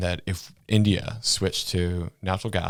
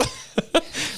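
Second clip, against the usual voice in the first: high airy laugh after the joke; breathy laugh. Real, not acted. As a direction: laugh it off, unvoiced. Laugh after mock quote.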